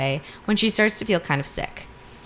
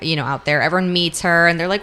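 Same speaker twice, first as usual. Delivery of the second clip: usual speaking voice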